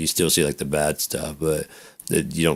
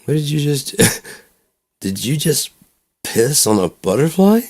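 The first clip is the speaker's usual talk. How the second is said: incredulous; 10 semitones higher than usual. Retold or imitated. Mock outrage retold.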